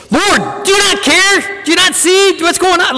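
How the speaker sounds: high, pleading cry of panic; desperate panicked cry. Shown as a demonstration. Fever-pitch plea, voice flying high. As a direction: apostles shouting at Jesus in the storm, panicked. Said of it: "like, a fever pitch".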